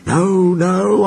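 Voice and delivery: high, scared protest; frightened refusal. A narrator's reading. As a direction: cry it out in fright. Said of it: "answered Toby".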